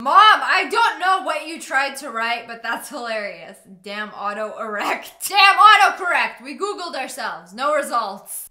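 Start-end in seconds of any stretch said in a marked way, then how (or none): none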